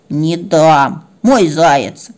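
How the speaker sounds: sullen, possessive dog voice; stubborn dog. Acted. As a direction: refuse like a sulky big brother. Dog refuses to share toy. (упрямо и угрюмо)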